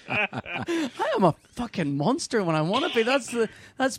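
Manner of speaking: laughing through the words, loud and high. Real, not acted. Laughing at himself as he confesses to his co-host, real laughter. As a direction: cackle at your own cheek.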